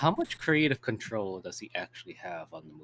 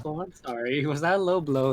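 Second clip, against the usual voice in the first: sarcastic mock apology; 4 semitones higher, 7 dB louder than usual. Mock apology quoting a critic.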